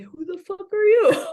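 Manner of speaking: high screaming yell, full force; night-terror scream. Retold or imitated. Son screaming at nobody during a night terror, shrieking. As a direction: shriek it like a half-awake kid in terror. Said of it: "stared out your window screaming".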